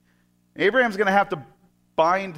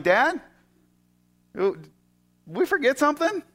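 put-on higher, boyish questioning son; Isaac's voice; 9 semitones higher than usual. Son voiced worried, asking his father. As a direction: ask like a worried boy tugging at his dad.

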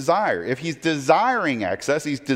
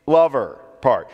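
"lover part" slowed and punched louder; stress on lover; 5 dB louder than usual. Shown as a demonstration. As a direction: lean hard on "lover", drawn out. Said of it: "The emphasis is on the".

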